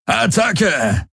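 high-pitched cry to charge; commander's soaring attack call; battle cry. A narrator's reading. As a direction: send the warriors into battle. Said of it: "rief Daraios".

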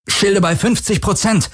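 höher, angestrengt gegen Lärm gerufen; gepresster Ruf. A narrator's reading. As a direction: call it out tensely over the din. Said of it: "knirschte er über den Lärm". (knirschend über den Lärm gerufen)

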